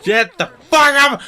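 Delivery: high, loud mock shout. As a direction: bark it at the crowd. Mock yelling at convention crowd.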